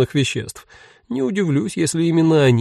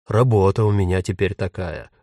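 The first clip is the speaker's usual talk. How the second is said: lightly voiced testy ghost, lower; grumpy retort; 6 semitones lower than usual. A narrator's reading. Ghost defends his job to Jen, low and testy. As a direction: answer back sullenly in a low voice. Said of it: "огрызнулся призрак".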